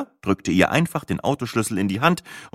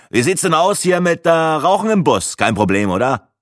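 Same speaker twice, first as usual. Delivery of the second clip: high, loud pushy smoker; Breitling's voice; 9 semitones higher, 5 dB louder than usual. A narrator's reading. Smoker asks the guide about smoking, pushy. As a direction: wheedle for a cigarette.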